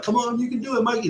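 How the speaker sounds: very high-pitched rallying cheer; crowd cheering. Retold or imitated. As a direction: pump him up like a whole crowd of buddies. Everybody pumping Mikey up.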